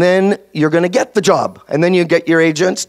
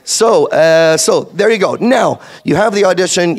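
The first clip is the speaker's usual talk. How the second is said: ordinary talk, nothing marked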